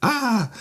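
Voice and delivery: very high, thin mock shriek; mock scream. Recapping how the stabbed man screamed, high mock scream. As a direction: imitate a little squealing scream at normal volume. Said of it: "screaming like a little".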